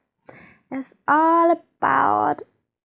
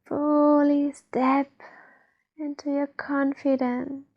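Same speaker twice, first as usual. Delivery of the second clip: guided meditation. Acted. Guide leads listeners to feel trust inside, guided meditation. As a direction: ease the listener inward, unhurried.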